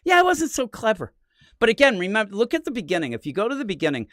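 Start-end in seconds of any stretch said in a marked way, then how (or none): 0.1-1.1 self-mocking. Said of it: "I'm like".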